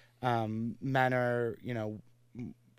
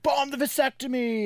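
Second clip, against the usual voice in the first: mock outraged protester; 13 semitones higher, 6 dB louder than usual. Mocking imagined outraged protesters.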